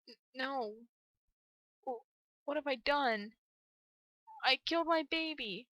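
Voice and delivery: falter, then let the horror rise in pitch; horrified realization. Acted. Parent realizing they killed their baby, horrified disbelief.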